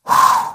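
long, forceful exhale; deep breath out. Retold or imitated. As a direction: blow the breath out to calm down. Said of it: "Took a breath".

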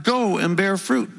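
"Go" stretched with emphasis; slow down and lean on "Go"; stress on GO. Retold or imitated. Preacher showing which word Jesus emphasized, stress on "Go". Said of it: "he emphasized that word".

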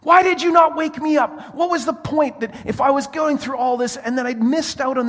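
indignant high-pitched outburst; angry shout. Retold or imitated. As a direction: yell at the crew for not waking you. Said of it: "And then I got angry".

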